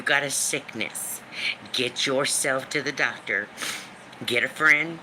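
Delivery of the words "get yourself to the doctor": normal talking voice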